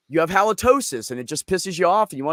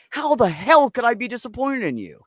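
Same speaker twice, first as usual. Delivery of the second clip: emphatic reassurance; 9 semitones higher, 5 dB louder than usual. Retold or imitated. Parent reassuring child, emphatic.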